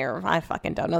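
ordinary talk

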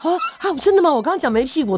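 high, shocked gasp of realization; startled disbelief. Retold or imitated. She realizes her slip to co-star, high startled disbelief. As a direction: sound caught out, high and stunned. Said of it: "she retells her own shock".

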